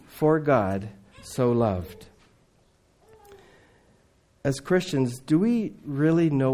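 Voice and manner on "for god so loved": much slower, lower, every word weighted; slow and solemn. Acted. Jesus tenderly telling Nicodemus of God's love, slow. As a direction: let each word land slowly. Jesus pours out his heart.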